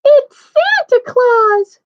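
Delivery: very high, loud, drawn-out squeal; Baby Bear's cry. A narrator's reading. Baby Bear discovers Santa in his bed, high drawn-out cry. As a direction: cry out slowly in a tiny bear voice. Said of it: "cried the Baby Bear".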